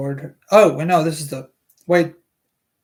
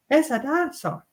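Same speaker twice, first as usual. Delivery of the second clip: higher female character voice; Alice Levin voice; 8 semitones higher than usual. Acted. Alice Levin to Doctor Stone, higher female voice. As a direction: play a chatty mother, pitched up. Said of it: "some sort of female voice".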